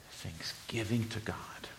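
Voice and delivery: slow whisper. Real, not acted. Preacher ending the verse in a whisper. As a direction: let the verse end in a breath.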